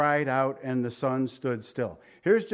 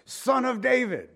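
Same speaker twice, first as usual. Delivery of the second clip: retold calling out; 8 semitones higher than usual. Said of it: "he calls Jesus".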